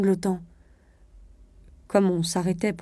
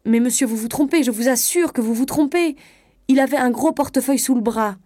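higher, louder, pleading protest; tearful insistence; 5 semitones higher, 5 dB louder than usual. A narrator's reading. Wife insisting to the conductor that he is wrong, pleading. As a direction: insist urgently, close to tears. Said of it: "Des larmes lui montaient aux yeux. Elle insista".

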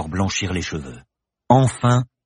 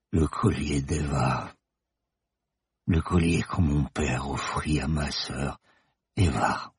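put-on slow, soft, tearful old man; moved old man; 4 semitones lower, 5 dB quieter, slower than usual. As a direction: lower and quieter, holding back tears. Old man moved, tear on cheek. (vieil homme ému, lent et doux)

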